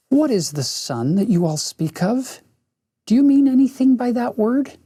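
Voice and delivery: soft, sweet cooing witch voice. Acted. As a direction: coo it like a wood pigeon. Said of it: "cooing softly".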